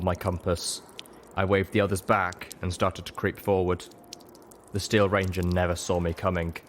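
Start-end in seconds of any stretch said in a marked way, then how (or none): none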